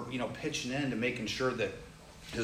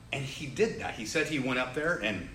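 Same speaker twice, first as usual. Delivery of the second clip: normal talking voice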